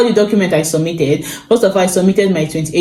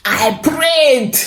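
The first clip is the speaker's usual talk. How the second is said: I prayed, repeated much higher for emphasis; emphatic repeat; 8 semitones higher than usual. Shown as a demonstration. Recalling the embassy morning, she repeats it in a much higher voice. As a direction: jump way up on the repeat.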